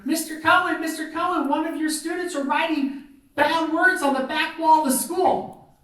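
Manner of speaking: put-on high, urgent kid voice; tattling child. Student telling teacher about bad words on the wall, high urgent tattling. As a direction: imitate a child running up to report trouble. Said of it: "and I hear".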